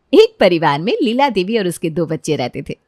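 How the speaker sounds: ordinary delivery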